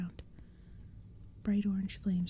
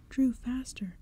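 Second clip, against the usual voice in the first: urgent cry; 5 semitones higher, 4 dB louder, slower than usual. Acted. Walker urging during escape.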